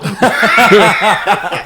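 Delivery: loud high burst of laughter. Real, not acted. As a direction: really crack up. Amused by a catchphrase.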